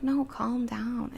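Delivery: ordinary talk, nothing marked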